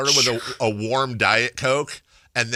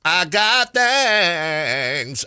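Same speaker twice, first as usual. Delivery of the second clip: hugely drawn-out, higher-pitched complaint; mock impatience; 6 semitones higher, 3 dB louder, much slower than usual. Bored meeting attendee wanting to leave, hammed-up drawn-out whine. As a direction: stretch every word in hammed-up exasperation.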